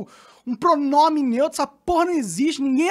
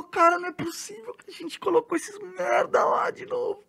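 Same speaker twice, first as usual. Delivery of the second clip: harsh disbelieving whisper; exasperated whisper; 5 semitones higher, 7 dB quieter than usual. Real, not acted. Vlogger hisses disgust at voters re-electing the government, harsh whisper. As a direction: hiss it out in disgust, half-voiced.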